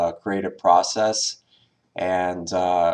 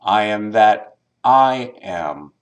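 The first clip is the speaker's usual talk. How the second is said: second "I" set apart, raised and emphatic; stress on second I; 5 dB louder than usual. Shown as a demonstration. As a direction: pause after "that", then lift the second "I". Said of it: "emphasizing each word in order".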